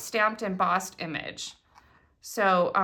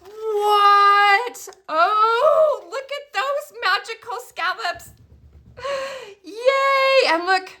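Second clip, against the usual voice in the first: much higher and louder, squealing with delight; real excitement; 11 semitones higher, 7 dB louder, much slower than usual. Real, not acted. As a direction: shriek with joy at the die cuts. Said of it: "Oh, I'm so excited".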